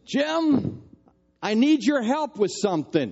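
high, loud call up the stairs; mock-yell for her husband from below. Wife calling husband from the kitchen, a loud high yell. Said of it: "my wife yelled up the stairs".

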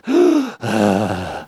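low gasp for air; breathless gasp. Real, not acted. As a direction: catch your breath with a gasp. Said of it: "I'm out of breath".

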